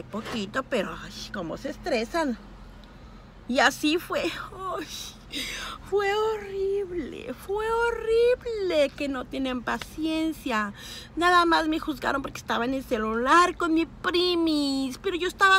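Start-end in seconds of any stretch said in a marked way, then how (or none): 4.8-10.7 slow, whiny complaint with a break in the voice; drawn-out lament over the rude shoppers. Real, not acted. Complaining to followers about impatient shoppers, whiny and slow. Retelling a humiliating checkout.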